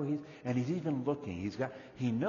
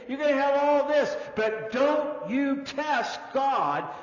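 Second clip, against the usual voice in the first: higher, rising sermon warning; fervent preaching; 8 semitones higher, 5 dB louder than usual. Acted. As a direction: drive the warning home to the congregation. Preaching at fuller force.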